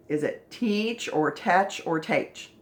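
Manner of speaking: "teach", "tetch", "teach" slow; trying vowel sounds. Shown as a demonstration. Teacher sounds out "teach" three ways. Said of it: "next one".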